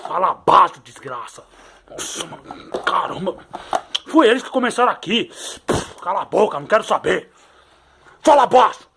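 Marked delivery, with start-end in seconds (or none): 1.9-3.4 breathy whispered grumble under the breath; hushed whisper. Acted. Toy told to keep it down, answering in a whisper. 8.2-8.9 Fala baixo yelled high and loud, Fala hit hard; shouting fala baixo. Acted. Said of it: "você tá gritando também?".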